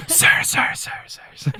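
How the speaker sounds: whispered echo, fading away; mouth-made echo dying into a whisper; reverb echo imitation. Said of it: "the reverb is turned up to".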